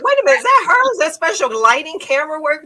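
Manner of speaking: 信じられないと驚く口まね、高く大きく; mock disbelief. Mock disbelief at her looks.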